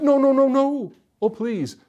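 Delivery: high, loud, hurried begging; urgent pleading. A narrator's reading. Villagers stopping the soldier from leaving, urgently pleading. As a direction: beg him not to leave.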